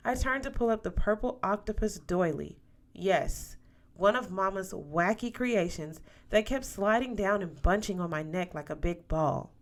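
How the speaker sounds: usual speaking voice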